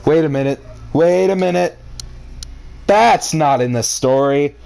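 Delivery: ordinary talk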